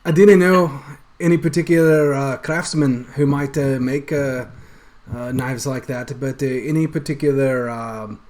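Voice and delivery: loud Scottish innkeeper fading toward plain; Scottish accent wearing off as he talks; slipping Scottish accent. Acted. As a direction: start in a thick Scottish brogue and let it slip. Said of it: "Actually, I'm slipping more".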